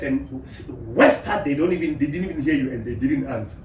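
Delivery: ordinary delivery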